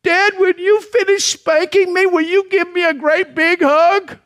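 put-on high, small, tearful child voice; little boy's quivering voice. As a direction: plead like a scared little boy. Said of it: "with his little quivering voice".

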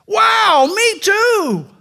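high thrilled exclamation; delighted, pitch it way up; excited. Acted. Rescuer thrilled they share a faith, high excited cry.

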